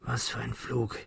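very soft, faint murmur; barely voiced, dazed and soft; weak, quiet speech. A narrator's reading. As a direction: speak faintly after coughing. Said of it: "begann leise zu sprechen".

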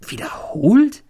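breathless shocked whisper on a rising question; hushed, incredulous echo; whispered disbelief. A narrator's reading. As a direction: whisper it back, stunned. Stunned echo of the plan.

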